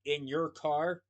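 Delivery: ordinary delivery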